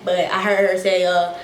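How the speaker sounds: usual speaking voice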